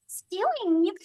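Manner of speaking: high, bright one-word line; Alex voice. Pretend. Asked for a character voice, a high Alex line. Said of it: "because of the Alex".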